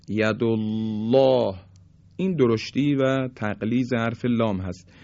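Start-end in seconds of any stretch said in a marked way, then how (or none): 0.1-1.5 یدالله with thick, full lam, slow; heavy lam. Shown as a demonstration. Teacher demonstrating heavy lam, drawn out. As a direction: stretch یدالله with an emphatic lam. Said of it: "یا".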